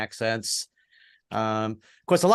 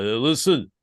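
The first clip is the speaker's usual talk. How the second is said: slurred, mumbling Philly boxer voice; Rocky; 3 semitones higher than usual. Acted. Boxer father lecturing his son, hammed-up Philly mumble. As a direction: mumble a lesson like a punchy fighter. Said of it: "He's like".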